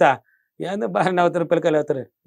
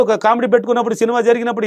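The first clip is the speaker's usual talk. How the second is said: ordinary talk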